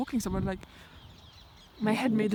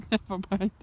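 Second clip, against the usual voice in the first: usual speaking voice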